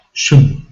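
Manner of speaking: shun with a schwa vowel; schwa suffix. Shown as a demonstration. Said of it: "pronounced with a schwa sound".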